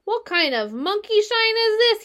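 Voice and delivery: high, loud, odd rat voice; a grumpy rat woken rudely; growled. A narrator's reading. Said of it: "he growled".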